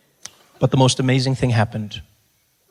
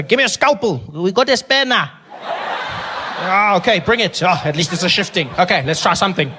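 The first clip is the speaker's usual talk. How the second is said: higher, careless doctor voice; sloppy doctor; 4 semitones higher than usual. Pretend. Imagined doctor to nurse, careless voice. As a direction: play a bumbling surgeon.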